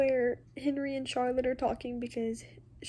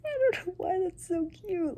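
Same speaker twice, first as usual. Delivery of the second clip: high, crying voice on cute; teary; 8 semitones higher than usual. Real, not acted. Reader tearing up over a sweet scene. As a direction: squeeze it out through tears. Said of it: "I'm really out here bawling".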